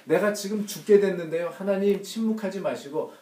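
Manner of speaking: a suffering son's loud plea; anguished plea. Acted. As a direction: beg God from the cross, voice lifted. Jesus voiced crying out to God.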